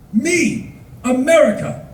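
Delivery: high, emphatic word-by-word declaration; proud introduction. Retold or imitated. Tourist introduces himself to bus driver, high and emphatic. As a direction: mirror the driver's broken-English announcement.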